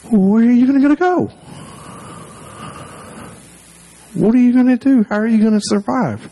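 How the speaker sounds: put-on very high, wailing kid brother; exaggerated high-pitched whiny pleading; tearful whine. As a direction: imitate a distraught little brother, sustained high pitch. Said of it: "kind of whine in his voice".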